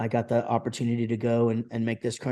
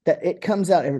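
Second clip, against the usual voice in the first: usual speaking voice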